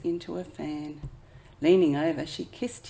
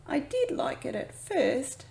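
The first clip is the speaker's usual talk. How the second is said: put-on high, small boy voice; young raccoon voice; 8 semitones higher than usual. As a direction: speak as a young raccoon cub. Chester child voice.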